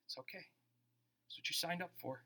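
very soft, easy reassurance; calm pastor reply. Retold or imitated. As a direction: soothe a panicked friend. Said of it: "He's like".

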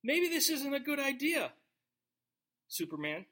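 sweetly steer your husband off a bad idea; gentle wife nudging. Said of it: "be like".

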